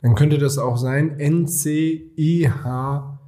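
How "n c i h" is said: slow spelled letters. Shown as a demonstration. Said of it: "also N I C H T".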